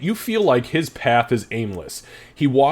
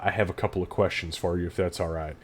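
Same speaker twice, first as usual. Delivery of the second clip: ordinary delivery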